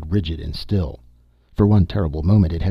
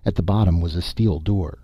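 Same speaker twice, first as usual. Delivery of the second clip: ordinary delivery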